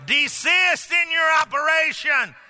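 ringing shout of authority; authoritative command. Shown as a demonstration. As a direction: order the devil off. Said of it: "with authority tell the devil".

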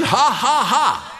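high, punched, spelled-out laugh; defiant mock laugh. Shown as a demonstration. Preacher showing joy in trials, defiant mock laughter. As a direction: laugh deliberately in the face of trouble.

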